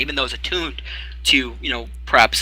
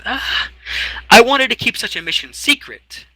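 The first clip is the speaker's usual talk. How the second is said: much higher, petulant voice; sulky excuse; 11 semitones higher, 3 dB louder than usual. Acted. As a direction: make a pouting excuse. Eric pouts excuse.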